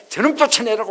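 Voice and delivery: put-on jealous mother loudly ordering the boy away; angry command. Sara ordering Ishmael out.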